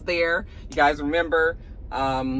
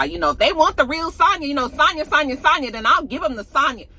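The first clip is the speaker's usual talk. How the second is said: raised, pumped-up voice; hyped up; 6 semitones higher, 4 dB louder than usual. Retold or imitated. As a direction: hype yourself up. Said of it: "trying to pump herself up to be like".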